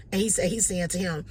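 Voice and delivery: usual speaking voice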